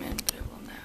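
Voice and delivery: soft whispered aside; whispering. Real, not acted. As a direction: whisper it quietly. Aside while zooming camera.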